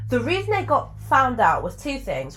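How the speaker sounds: ordinary talk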